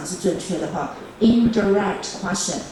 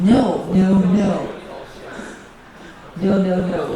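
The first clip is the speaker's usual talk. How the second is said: emphatic no; 4 dB louder than usual. Real, not acted. Teacher rejects a wrong answer.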